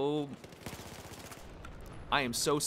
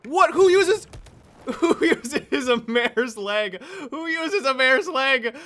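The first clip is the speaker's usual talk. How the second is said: high, slow laughing mock outrage; laughing disbelief; 10 semitones higher, 8 dB louder, slower than usual. Real, not acted. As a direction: cackle at the silly weapon. Mocking enemy's odd weapon.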